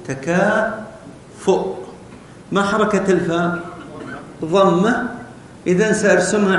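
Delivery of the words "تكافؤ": تكافؤ drawn out very slowly, syllable by syllable; slow spelled-out word. Shown as a demonstration. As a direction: stretch تكافؤ slowly so pupils hear the hamza. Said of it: "هذا الحرف الذي قبل الهمزة". (نطق تكافؤ ببطء شديد مقطعا مقطعا)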